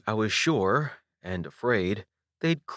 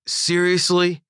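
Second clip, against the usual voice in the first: higher and louder, incredulous; outraged disbelief; 9 semitones higher, 6 dB louder, slower than usual. A narrator's reading. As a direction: throw it at him in disbelief.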